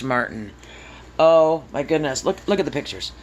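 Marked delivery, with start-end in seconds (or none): none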